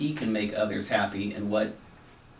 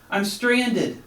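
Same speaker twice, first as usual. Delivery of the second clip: high, loud desperate call; 10 semitones higher, 4 dB louder than usual. A narrator's reading. Stranded frog calls to rescuers, high loud call. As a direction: shout it across the water. Continues shouting for help.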